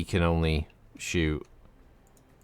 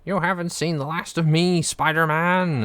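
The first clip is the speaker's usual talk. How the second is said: sputtering foiled villain; 10 semitones higher than usual. Retold or imitated. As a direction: shake your fist as the beaten villain. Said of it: "it's the Green Goblin".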